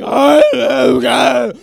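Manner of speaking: loud choking cry of Oh God; pained yell. Retold or imitated. Maced kid yelling in the bathroom, pained. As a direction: yelp it out, eyes burning.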